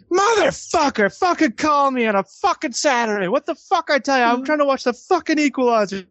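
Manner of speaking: a cartoonish furious father on the phone; dad ranting about a Saturday call, in a high put-on voice; angry dad. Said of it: "he's like".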